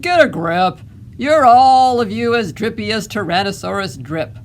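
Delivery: loud, exasperated dad; stern rebuke. A narrator's reading. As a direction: berate them forcefully. Dad berating his frightened family.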